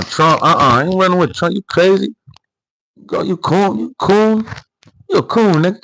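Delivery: mock heated retort.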